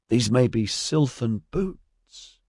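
excited, with the pitch lifted and words drawn out. A narrator's reading. Traveler examines footprints he thinks are sylvan boots, excited. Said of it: "he said excitedly".

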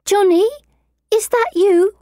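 put-on high, sweet little girl; a sweet child's spirit calling. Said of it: "high and sweet as a girl's".

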